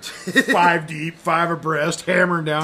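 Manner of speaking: mock hollering rafter; mock charge yell. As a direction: bellow it like a boater charging in. Mocking private boaters charging rapids.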